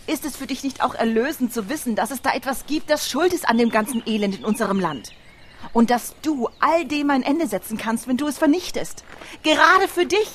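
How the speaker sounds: high, urgent pleading that builds to a loud peak; impassioned plea. Acted. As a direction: plead with rising fervour, ending almost in a shout. Urgent plea to convince a doubter.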